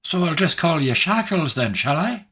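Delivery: high, teasing Irish lilt; a playful jab at a new nickname; cheeky Irish voice. Acted. Player voices Barry cheekily.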